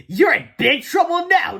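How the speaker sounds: slow, high, loud taunt; gloating chuckle. A narrator's reading. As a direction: relish someone else's trouble. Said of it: "chuckled Miss Anthrop".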